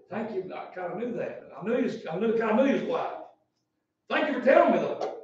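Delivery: acting out a subdued, obliging reply; politely underwhelmed. Man answering Jesus about his living son, politely underwhelmed.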